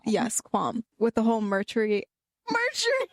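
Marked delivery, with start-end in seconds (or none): 2.5-3.1 laughing. Real, not acted. Cracks up mid-word.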